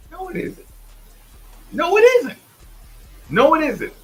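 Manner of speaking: host heatedly rejecting the claim; emphatic denial. Real, not acted. Host emphatically rebutting critics.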